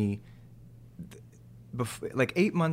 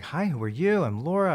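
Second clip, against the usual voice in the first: higher-pitched, imitated eager greeting; perky introduction; 7 semitones higher, 4 dB louder than usual. Young actress introducing herself to a castmate, imitated perkily. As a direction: mimic a chirpy self-introduction. Acting out first meeting with colleague.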